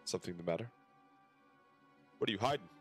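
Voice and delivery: ordinary talk, nothing marked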